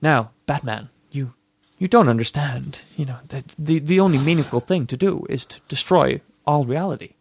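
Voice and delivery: lightly voiced villain, hushed and smooth; a hint of a calm, reasoning villain; James Woods impression. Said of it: "James Woods is going".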